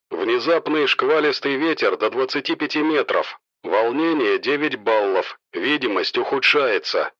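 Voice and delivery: tower controller over the radio. A narrator's reading. Tower warns the helicopter crew of squalls, radio voice. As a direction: read it as a filtered radio transmission.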